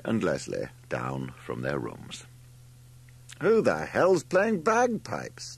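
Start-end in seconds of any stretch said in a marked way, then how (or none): 3.4-5.6 high, very slow outraged question; indignant demand. A narrator's reading. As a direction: demand it, stretching the words high. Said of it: "demanded Larry as he swept in".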